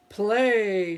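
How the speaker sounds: loud booming order; giant's command. Acted. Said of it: "said the giant".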